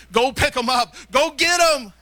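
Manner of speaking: rousing urging. Acted. Preacher urging people to fetch souls, loud call. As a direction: send them off with a rising shout.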